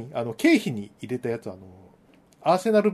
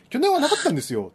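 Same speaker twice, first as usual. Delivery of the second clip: hurried, higher rush of words; fast talking; 10 semitones higher, faster than usual. Retold or imitated. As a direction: blurt the excuse out fast. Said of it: "早口で まくし立てて".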